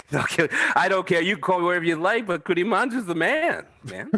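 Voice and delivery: high, laughing protest. Real, not acted. Laughing defiance.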